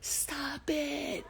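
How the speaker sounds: high, sharp cry of stop; retold scream. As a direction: yell it to halt the monsters. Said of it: "and I screamed out".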